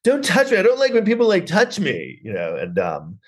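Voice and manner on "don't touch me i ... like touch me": high-pitched mock aversion; standoffish recoil. Imitating the girl rejecting touch, high standoffish protest. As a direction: fend them off in a high, prickly voice. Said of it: "really standoffish".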